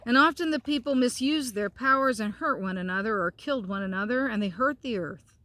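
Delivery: gentle storybook narration; reading to children. A narrator's reading. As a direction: read it out like a bedtime story. Continues reading the book.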